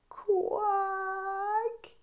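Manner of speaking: high, soft quack; a tired little quack; weary duck quack. Acted. Duck's reply.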